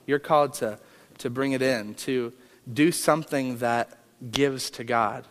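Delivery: ordinary talk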